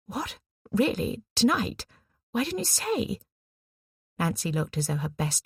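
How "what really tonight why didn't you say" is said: high, climbing disbelieving questions; shocked surprise. A narrator's reading. Nancy learns her friend flies home tonight, shocked. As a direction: start stunned, rise to a high protest. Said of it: "Nancy's jaw dropped".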